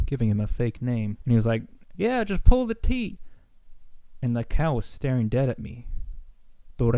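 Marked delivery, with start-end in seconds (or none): 2.0-3.2 higher and louder, a hammed-up put-on uncle; mock uncle voice. Uncle urging the kid at the cow, high loud mock voice. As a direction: play the uncle big, pitched up and loud.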